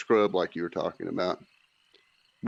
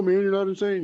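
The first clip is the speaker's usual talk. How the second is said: mock reassurance; 11 semitones higher, 3 dB louder than usual. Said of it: "You guys know".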